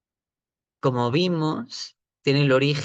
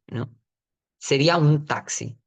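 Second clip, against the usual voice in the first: normal talking voice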